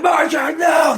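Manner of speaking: very high, loud yelled protest; hammed-up husband shouting at his wife; mock shout. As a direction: yell it at her in falsetto. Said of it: "And he's like".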